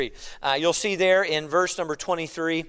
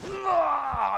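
mock unnatural scream; 10 semitones higher than usual. Preacher imitates the demon leaving the man, mock scream. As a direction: imitate a demon's scream without full volume. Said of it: "screaming and unnatural".

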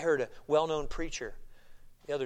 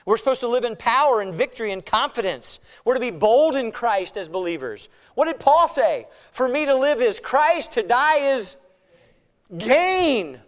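forceful preaching, key words punched; emphatic preaching; 8 semitones higher, 11 dB louder than usual. Real, not acted. Preacher urging believers to be bold in Christ, emphatic preaching. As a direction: preach it boldly with big rises and falls.

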